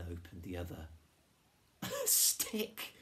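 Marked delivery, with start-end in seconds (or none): 1.8-2.9 very high, loud gasped exclamation; astonished gasp. A narrator's reading. Girl discovering what the dog dropped, amazed gasp. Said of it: "she gasped".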